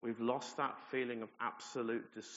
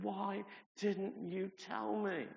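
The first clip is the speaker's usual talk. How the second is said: slow, higher, pained question; upset plea; 8 semitones higher, much slower than usual. Retold or imitated. Andrea asking him why he stayed silent, slow and upset. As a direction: plead with him in disbelief. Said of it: "she was so upset with me".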